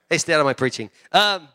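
normal talking voice

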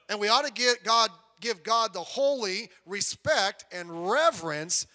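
booming pulpit emphasis; preaching emphasis. Real, not acted. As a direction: lean hard into holy respect.

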